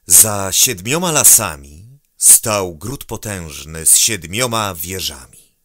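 ordinary delivery

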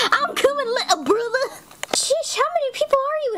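put-on chirpy high storybook girl. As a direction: call out in a high voice. High character voice.

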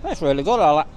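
talking while chewing; words round a mouthful of chips; mouth full. Real, not acted. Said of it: "I shouldn't talk with my mouth full".

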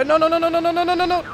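rapid high shouted nos; panicked no. Real, not acted. Racer shouting as his draft slips away, panicked. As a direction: yell no over and over as it slips away. Car about to get passed.

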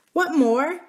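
put-on high-pitched child's question; small girl asking, curious; little princess. Princess asking the boy's full name, high and curious.

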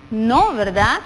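ordinary delivery

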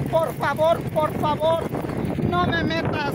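please, please, pleading upward. Real, not acted. Woman begs the saint not to let her fall, pleading.

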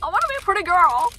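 put-on high, fast, girly and loud. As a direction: gush like a little girl. Mock girlish wish.

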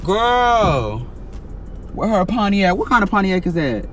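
loud, high call to the other car; calling out to other driver. Real, not acted. Yelling at a driver backing out alongside, loud and high. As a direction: shout across the lot at her, sis.

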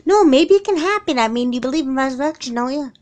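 high, loud, rushing hope; clinging to a wish, voice leaping up; eager hopeful pleading. Acted. Daughter pleading with mom that Dad could come back, eager and high.

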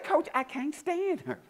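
high, slow imitation of a teenage player; mock girlish grievance; complaining girl. Sulky player quoted to coach.

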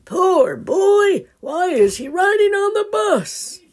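high, loud pitying wonder; puzzled grandpa. Acted. Grandpa spotting Tommy on the bus, high puzzled voice. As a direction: wonder aloud about the boy.

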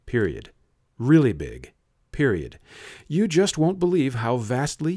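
plain reading voice

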